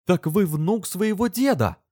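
high, drawn-out exclamation; slow, high-pitched outburst of discovery; exclaiming in realization. A narrator's reading. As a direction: cry it out in sudden realization. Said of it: "воскликнула".

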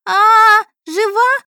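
put-on very high, loud girlish screech; shrieking. A narrator's reading. Daughter seeing the patient wake, ear-splitting shriek. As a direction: squeal in shock at full pitch. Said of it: "заверещала дочь Ирина, да так, что у меня уши заложило".

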